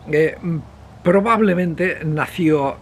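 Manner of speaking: ordinary delivery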